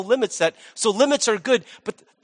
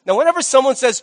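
ordinary talk, nothing marked

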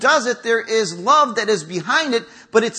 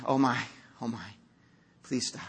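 low, very soft murmured dismay; feeble, dismayed pleading; 10 semitones lower, 11 dB quieter than usual. Acted. God looking at our weaknesses, feebly dismayed. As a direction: plead weakly under your breath.

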